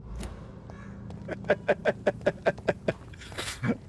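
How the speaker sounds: long, breathy giggling fit; real laughter. Real, not acted. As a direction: laugh helplessly at yourself. Laughing at own bad accent.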